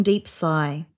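ordinary delivery

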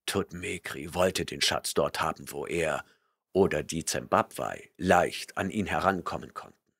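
brooding barbarian murmur; murmuring Conan. A narrator's reading. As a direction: mutter it to yourself. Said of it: "murmelte Conan".

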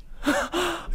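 sharp high gasp; shocked gasp. Real, not acted. Shocked at the price.

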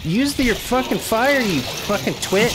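high, loud angry yell at the useless character; furious cursing. Real, not acted. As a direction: scream abuse at the hero. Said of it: "I'm cursing like a sailor".

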